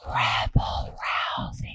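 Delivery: soft unvoiced whisper. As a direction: whisper close to the mic. Whispers near the mic.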